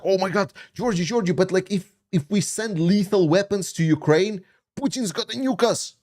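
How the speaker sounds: high mocking voice of a panicky doubter; mocking critics. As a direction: ape the people who always warn him, pitched high. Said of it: "Every single thing is like".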